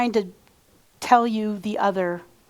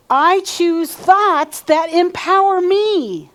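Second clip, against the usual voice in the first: slow, rising louder and higher with each repeat; leading an affirmation; 4 semitones higher, 4 dB louder, slower than usual. Shown as a demonstration. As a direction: call it out stronger every time. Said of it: "So say this after me".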